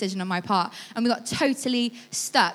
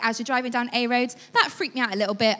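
ordinary talk

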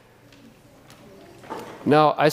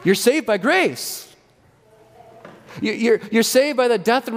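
sincere proclamation; 9 semitones higher than usual. Real, not acted. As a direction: proclaim it tenderly, drawing it out. Pastor proclaiming grace.

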